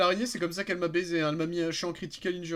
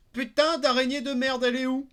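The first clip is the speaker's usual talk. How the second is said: angry; 10 semitones higher, 7 dB louder than usual. Real, not acted. Cursing at the spider attacking.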